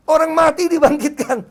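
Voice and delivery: high and loud, hammering the miracle home; emphatic proclaiming. Real, not acted. Politician on a talk show, preaching to the host, loud and emphatic.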